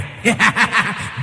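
hohes unheimliches Lachen; eerie laugh. A narrator's reading. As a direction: lach hoch und gespenstisch. Ghostly visitor laughs outside.

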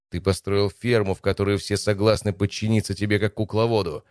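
ordinary delivery

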